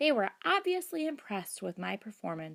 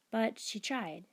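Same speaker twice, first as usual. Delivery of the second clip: plain reading voice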